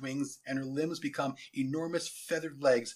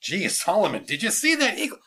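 loud, rising, eager question; excited lieutenant; 6 semitones higher, 8 dB louder than usual. Acted. Lieutenant running up to his commander, excited. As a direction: gush it like an overexcited subordinate.

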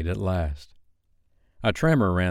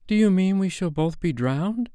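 high-pitched dismayed question, rising at the end; alarmed; 11 semitones higher than usual. A narrator's reading. Wendy realising they may both drown, alarmed.

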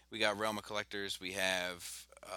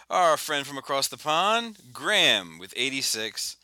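slow, louder announcer build; contest announcer; 4 semitones higher, 6 dB louder, slower than usual. Pretend. Host reveals winners to podcast listeners, announcer style. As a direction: draw it out for the big reveal.

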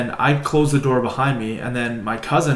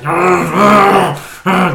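loud, higher growling argh; troll growl; 6 semitones higher, 11 dB louder, much slower than usual. Retold or imitated. Troll on the truck roof growling at the boys, loud growl. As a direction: growl like a chainsaw troll at the kids. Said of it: "growling at us".

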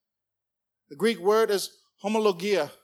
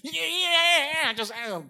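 conspiracy zealot; 10 semitones higher, slower than usual. Retold or imitated. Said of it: "Just like".

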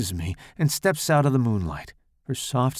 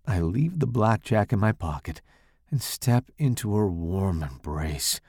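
usual speaking voice

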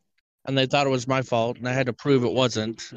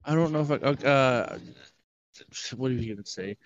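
usual speaking voice

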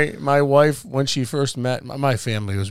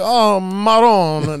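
drawn-out, higher, louder Italian lament; old Italian exclamation; 6 semitones higher, 5 dB louder, much slower than usual. Retold or imitated. Demonstrating how old Italians exclaim, Italian accent. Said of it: "old Italians? They go like".

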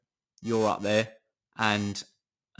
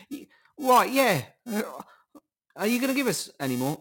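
much higher, squeaky mock plea; mock poor person; 12 semitones higher, 3 dB louder than usual. Mocking poor people begging the World Bank, squeaky plea.

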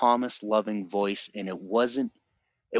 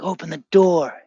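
high, loud desperate call; pleading yell to be let out; 9 semitones higher, 5 dB louder than usual. Retold or imitated. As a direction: shout it through the door. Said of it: "I'm screaming".

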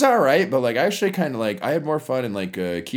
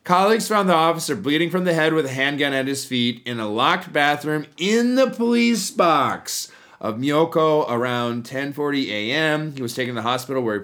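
news read; 5 semitones higher, 3 dB louder than usual. Acted. Reading an article to the podcast audience, news-anchor style.

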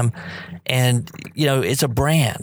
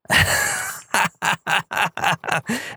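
real laugh; 5 semitones higher than usual. Real, not acted. Host reliving the flattering moment, laughing for real. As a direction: laugh at the memory of it. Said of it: "I just started laughing, you know, just like I did just now".

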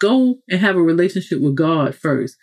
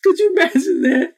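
high, laughing question; real amusement, pitched high; 12 semitones higher than usual. Real, not acted. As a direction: ask it through a laugh. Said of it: "I'm laughing".

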